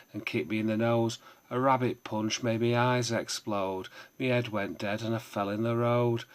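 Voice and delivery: loud, punchy performance of a comic poem; full-voiced performance reading, hitting the rhymes; poem recital. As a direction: declaim it like a pub stage poet.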